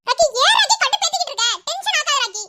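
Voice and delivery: high, loud irritated outburst; angry, exasperated. Acted. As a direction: snap at him, at the end of your patience. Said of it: "ஏன் இவ்ளோ கோவமாகறீங்க?".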